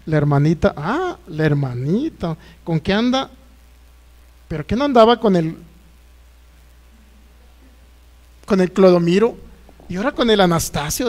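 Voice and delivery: a hint of a disapproving, gossipy churchgoer; lightly voiced church gossip. Acting out gossiping church folk. (voz levemente fingida de chismoso de iglesia)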